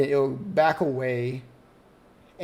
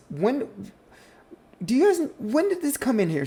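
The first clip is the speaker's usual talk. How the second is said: startled question; 11 semitones higher, 4 dB louder than usual. Acted. Serena startled by lizard.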